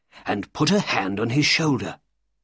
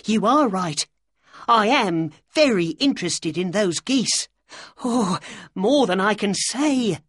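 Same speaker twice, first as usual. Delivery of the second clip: high, gushing little man; excited; 10 semitones higher than usual. A narrator's reading. Little man to Holmes about the geese, excitedly. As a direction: pour out eager agreement in a high voice. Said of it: "said the little man excitedly".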